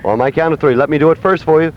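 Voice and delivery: usual speaking voice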